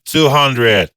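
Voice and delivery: ordinary delivery, nothing marked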